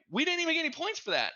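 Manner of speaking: high, disbelieving complaint; complaining to a teammate about missing points; exasperated. Retold or imitated. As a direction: whine at the game in disbelief. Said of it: "and I'm like".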